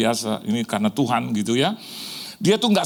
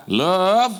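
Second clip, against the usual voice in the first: louder, higher call of Love; called-out word; 5 semitones higher, 4 dB louder than usual. Acted. Preacher calling out the word Love to the congregation. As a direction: lift the word Love like a cry.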